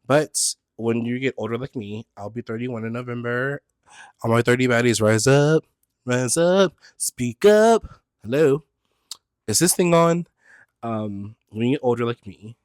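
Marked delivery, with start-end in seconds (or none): 4.2-7.8 loud, climbing hype call; rally your age group; hyped call to her thirty baddies; rallying cry. Rallying thirty-year-old fans. 8.2-10.3 loud, higher joking call; joking mic check to silent fans; mock mic check.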